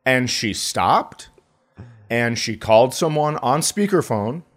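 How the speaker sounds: normal talking voice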